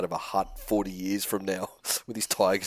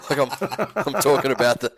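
laughing; 6 semitones higher, 7 dB louder than usual. Real, not acted. Denies the homophobia jibe, laughing.

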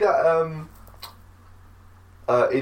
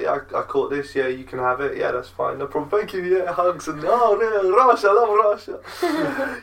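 put-on a big, loud, happy Russian accent, pitched up; 12 semitones higher, 4 dB louder than usual. S0 voices the Russian guy handing over a rabbit, hammed-up Russian accent. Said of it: "It's a really good accent".

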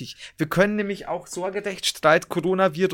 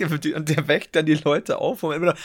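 usual speaking voice

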